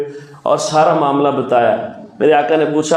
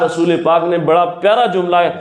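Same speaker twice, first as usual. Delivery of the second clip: ordinary talk, nothing marked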